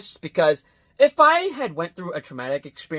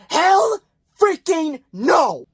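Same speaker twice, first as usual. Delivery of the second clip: drawn-out high exclamation; emphatic refusal; 10 semitones higher, 4 dB louder, much slower than usual. Real, not acted. Reviewer refusing to face a chainsaw clown, emphatic and drawn out. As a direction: stretch each word into a big refusal.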